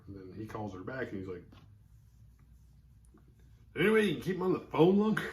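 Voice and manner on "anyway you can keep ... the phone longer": mock cop question. Mocking the cop on the phone.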